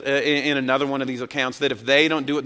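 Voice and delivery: ordinary delivery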